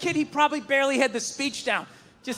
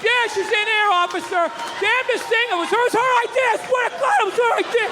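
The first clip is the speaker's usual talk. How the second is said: high, frantic pleading to the officer; panicked pleading; 10 semitones higher than usual. Acted. Man pleading with the officer, high panicked voice. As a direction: babble excuses to the cop in a panic. Panicked guy blaming cops on her.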